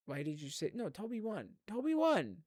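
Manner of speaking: usual speaking voice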